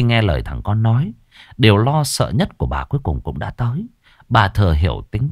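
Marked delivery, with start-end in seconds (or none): none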